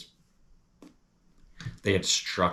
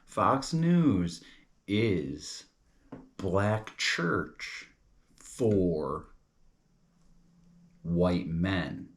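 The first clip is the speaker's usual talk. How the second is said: word by word with long pauses; slow, deliberate spelling-out; much slower than usual. Shown as a demonstration. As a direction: lay out each piece for the listener to follow. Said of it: "Now follow this".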